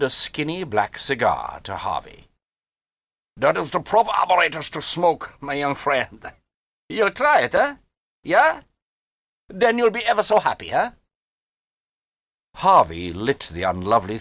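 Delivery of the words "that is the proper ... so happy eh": high-pitched, coaxing German character; genial German gentleman. Acted. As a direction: coax like a sly German uncle. The German offers Harvey a cigar.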